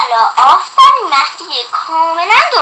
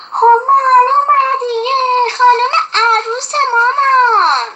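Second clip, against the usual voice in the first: much higher, slow, emphatic answer; loudly; 10 semitones higher, 3 dB louder than usual. A narrator's reading. Girl answering mother's riddle, high and loud. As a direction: call it out proudly as obvious. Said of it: "مهدیه با صدای بلند گفت".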